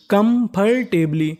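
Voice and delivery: Comfortably slowly as kam-phar-teb-lee; syllable by syllable. Shown as a demonstration. Teacher sounding out a long word, syllable by syllable. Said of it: "तो meaning बनी".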